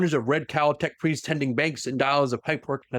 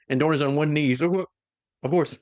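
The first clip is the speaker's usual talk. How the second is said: ordinary talk, nothing marked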